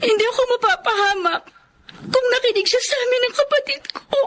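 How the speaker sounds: high, tearful voice breaking; crying hurt. Acted. Daughter telling her boss about her mother, crying. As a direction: let the old hurt spill out.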